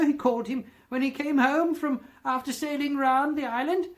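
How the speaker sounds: very high, loud and eager; calling out childhood memories, carried away; excited cry. A narrator's reading. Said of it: "cried Scrooge".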